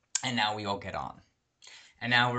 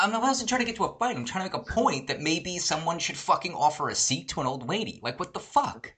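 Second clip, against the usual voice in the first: higher, rushed protest at the start; indignant self-defence; 6 semitones higher than usual. Retold or imitated. To wife after near fight over a seat, indignant. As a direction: argue your point in exasperation.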